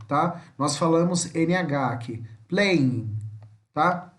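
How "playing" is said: final G dropped in playing; playing with silent G. Shown as a demonstration. Teacher showing students the NH ending, playing with silent G. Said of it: "Esse G final não pronuncia".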